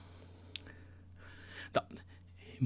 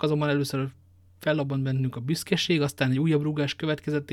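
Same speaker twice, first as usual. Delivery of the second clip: ordinary delivery, nothing marked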